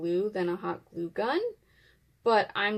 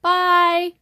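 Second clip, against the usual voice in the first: high, loud bright farewell; cheery goodbye; 9 semitones higher, 12 dB louder than usual. Real, not acted. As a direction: sing out the goodbye. Cheery sign-off to viewers.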